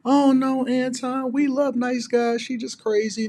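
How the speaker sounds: high, loud, sing-song mock of a protesting woman; mocking female commenter. Mimicking women in his comments, high mocking voice. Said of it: "women in the comments that say stuff like".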